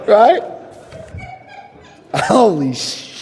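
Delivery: ordinary delivery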